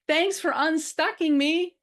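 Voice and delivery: high, loud, drawn-out kiddie voice; little girl turtle. Acted. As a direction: thank them like a small child, slowly and loudly. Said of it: "Esmeralda swims over to me and says".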